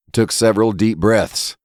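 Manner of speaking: plain reading voice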